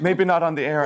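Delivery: amused, brushing off the offer with a laugh. Real, not acted. Host laughs off a Portuguese lesson on air, laughing.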